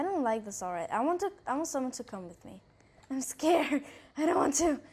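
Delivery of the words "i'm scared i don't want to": higher, louder nervous whining; real nervous reluctance, pitched up; scared whine. Real, not acted. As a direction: protest in a high, frightened voice. Scared before entering the maze.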